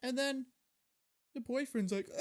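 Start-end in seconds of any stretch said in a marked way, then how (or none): none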